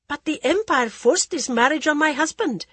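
put-on timid and pleading young woman; young wife voice. Jaya protests.

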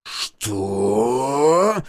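drawn-out rising roar; outraged roar. A narrator's reading. Official hears of the threat by phone, roaring. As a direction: let the word swell in outrage. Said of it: "Рёв невидимого Дмитрия Владимировича оглушал".

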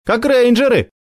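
put-on high thrilled girl's exclamation; delighted girl. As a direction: squeal it with delight. Sonya delighted.

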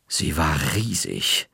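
ordinary delivery, nothing marked

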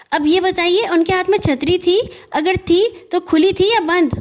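put-on high, eager little boy asking in a rush; a worried boy firing questions; young boy Gaggu. Anxious boy Gaggu pesters the postman, high and eager.

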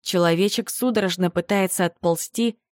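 usual speaking voice

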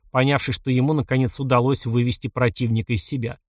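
ordinary delivery

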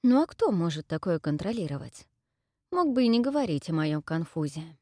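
ordinary delivery, nothing marked

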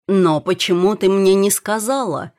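loud, astonished question; shaken. A narrator's reading. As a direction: demand to know, in shock. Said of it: "потрясённо спросил он". (потрясённо)

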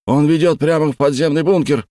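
loud high desperate cry. A narrator's reading. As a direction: cry out in despair. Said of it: "в отчаянии вскричал майор Хоук".

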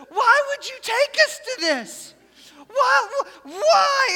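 high, loud crying wail; bawling girl. Retold or imitated. Sobbing student begging her youth pastor why, high and loud. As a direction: cry it out through tears, pleading. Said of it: "and she is bawling".

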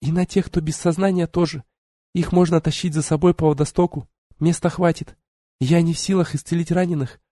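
put-on high, brisk young woman; young sorceress voice. Tiari voiced higher.

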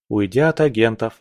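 ordinary delivery, nothing marked

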